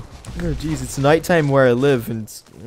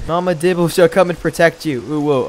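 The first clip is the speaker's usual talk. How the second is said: normal talking voice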